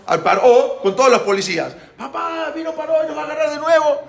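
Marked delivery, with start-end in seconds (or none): 2.0-4.0 high, rushed alarmed cry; imitated frightened children. Children warning papá that Paró is back, scared cry. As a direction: call for dad in a high child's panic. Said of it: "los niños se asustaron".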